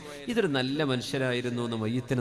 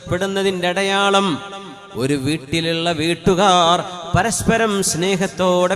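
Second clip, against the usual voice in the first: loud, higher and drawn-out sermon voice; preaching at full force; 4 semitones higher, 11 dB louder, slower than usual. Acted. Preacher to congregation on God's love for a home, loud preaching. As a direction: proclaim it, stretching the words.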